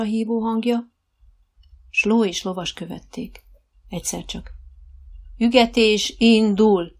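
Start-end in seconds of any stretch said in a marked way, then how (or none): 5.4-7.0 called command. A narrator's reading. Coach calling out the trot command across the arena, loud. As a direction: call it across the riding ring.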